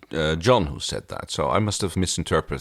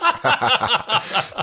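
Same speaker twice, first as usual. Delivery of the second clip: high laugh; 3 dB louder than usual. Real, not acted. Laughing at the Pilot line.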